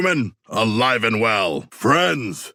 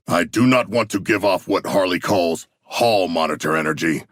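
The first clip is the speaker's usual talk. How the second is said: ordinary talk, nothing marked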